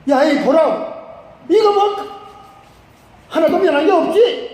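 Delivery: high, loud North Korean-style scolding; angry outburst. Acted. General finding his skin unchanged, loud angry complaint. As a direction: play an indignant general demanding an explanation. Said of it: "이러면서 막 화를 내는 거야".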